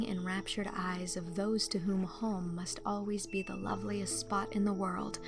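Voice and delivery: ordinary delivery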